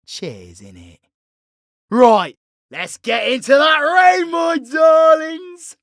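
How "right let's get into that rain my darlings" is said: loud, higher rallying cry; hollered pep-up to the listener; rallying shout. Acted. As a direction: rouse the crowd, belt it out. Rallying call to fight.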